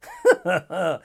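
short chuckle; amused laugh. Real, not acted. Calls the radio caller charismatic, amused chuckle. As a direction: laugh to yourself. Laughs at his own remark.

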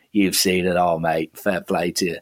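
quiet, low English bloke impression; English accent. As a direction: slip into an English accent, dropping the voice. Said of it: "Don't know why I've got an English accent".

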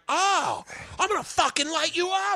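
high strained mock shout; hammed-up high-pitched menace; threatening friend. Imitating Bobby's gleeful threat.

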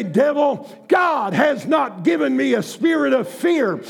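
bold declaration. Acted. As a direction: rebuke the devil with authority. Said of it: "with faith begin to pray and say".

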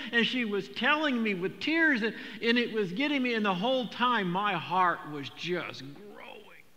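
preaching at full force, high and loud; impassioned preaching. Real, not acted. Pastor tells the congregation of his grandchild, passionate preaching. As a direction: build the story with fervor.

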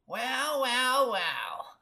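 high, scratchy screech gliding down; screechy old woman's greeting. A narrator's reading. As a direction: sound like a scratched blackboard. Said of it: "said a scratchy voice".

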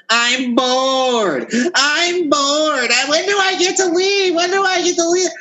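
high, whiny mock complaint; mock whining. Ranting host mimics bored partygoers who want to leave, mock whining.

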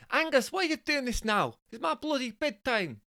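very high falsetto Scottish complaint; comic high Scottish woman's voice. Acted. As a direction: moan as a put-out Scottish wife. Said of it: "Jessica is actually Scottish".

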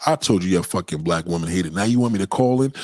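ordinary talk, nothing marked

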